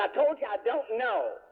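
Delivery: high, slow mock exasperation; exasperated insistence. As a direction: imitate a pressed girl digging in, word by word. Girl insists, pressed a second time.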